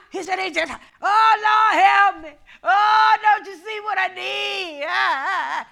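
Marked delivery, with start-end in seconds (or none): 0.4-4.8 wailing, loud and high, imitating a desperate prayer; mock crying plea. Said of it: "you up there hollering". 4.9-5.7 mock wailing cry. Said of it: "you up there hollering".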